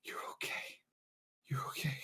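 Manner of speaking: soft whisper, voice almost gone with tears; tearful whisper. Acted. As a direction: whisper it through welling tears. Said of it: "You'd be like".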